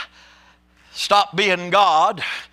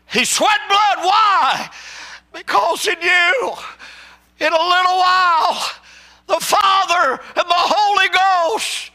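preaching at full force; 11 semitones higher than usual. Acted. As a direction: shout it to the rafters.

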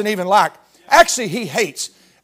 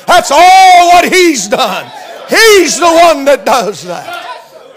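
slow, loud, high preaching holler; preaching at full force; 5 semitones higher, 7 dB louder, much slower than usual. Real, not acted. Preacher crying out to the congregation, slow and loud. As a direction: stretch every word, shouting with joy.

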